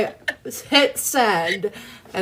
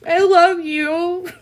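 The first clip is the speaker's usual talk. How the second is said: high, very slow, tearful declaration; crying; 10 semitones higher, 3 dB louder, much slower than usual. Real, not acted. Streamer tells a friend she loves her, crying. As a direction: let the words break with emotion. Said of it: "Stop making fun of my crying".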